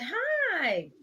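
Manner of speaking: high, sweet polite hello; polite greeting. Retold or imitated. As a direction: put on a friendly customer voice. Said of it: "I said".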